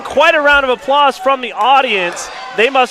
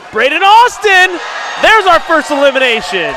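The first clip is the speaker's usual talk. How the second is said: excited shout; 6 semitones higher, 3 dB louder than usual. Real, not acted. Announcing first elimination.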